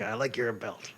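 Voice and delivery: quiet mock Spanish accent. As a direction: imitate him with a light, soft Spanish accent. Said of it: "he has a Spanish accent right now".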